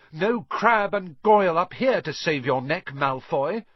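high, loud challenge; projected, goading call. A narrator's reading. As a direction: call a taunt to a rival. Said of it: "Harry called".

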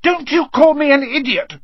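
very high-pitched, offended protest; indignant retort. A narrator's reading. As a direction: stand up to an insult in a high, indignant voice. Neville's indignant reply in quarrel.

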